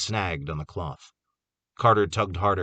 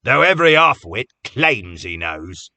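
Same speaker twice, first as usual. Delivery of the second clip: scornful; 4 semitones higher, 9 dB louder than usual. A narrator's reading. Cobb's scornful jab at Jake.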